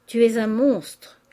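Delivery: loud, drawn-out insult; angry accusation. A narrator's reading. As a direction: throw it at your sister slowly and forcefully. Sisters' acted quarrel, an insult.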